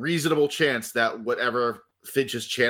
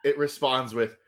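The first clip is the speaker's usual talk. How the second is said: usual speaking voice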